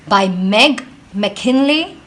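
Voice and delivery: usual speaking voice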